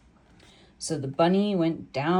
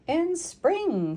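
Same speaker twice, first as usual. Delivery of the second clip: squeaky groundhog line; 13 semitones higher than usual. Acted. Groundhog answers in the song.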